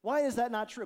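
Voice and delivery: ordinary delivery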